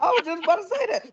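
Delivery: high, quick joking echo; mock agreement. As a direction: rattle it off high, in playful agreement. Repeats the joking echo after laughter.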